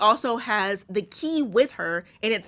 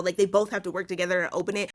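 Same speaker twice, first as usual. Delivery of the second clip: usual speaking voice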